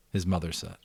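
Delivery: ordinary delivery, nothing marked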